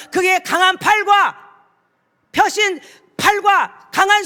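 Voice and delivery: loud, high, ringing sermon delivery; fervent preaching. Acted. Pastor proclaiming to his church, in full-force preaching. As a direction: preach it out to the whole congregation.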